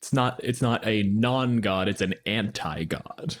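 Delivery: ordinary talk, nothing marked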